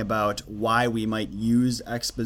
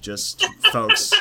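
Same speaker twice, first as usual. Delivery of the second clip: much higher, louder ironic aside; mock folksy; 6 dB louder than usual. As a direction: toss it off in a put-on folksy voice. Ironic aside about herself.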